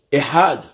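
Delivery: "Ehad" with a clear het and a short A, falling; voiced het, short vowel. Shown as a demonstration. Teacher demonstrating correct Ehad, het sounded, vowel short. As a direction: say "Ehad" leaning on the h, closing quickly on D. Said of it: "le rallonger en prononçant le het".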